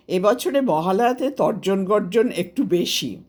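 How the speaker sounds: usual speaking voice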